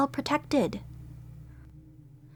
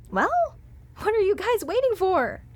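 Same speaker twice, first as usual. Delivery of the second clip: eager urging; 8 semitones higher than usual. Acted. Eager call to follow.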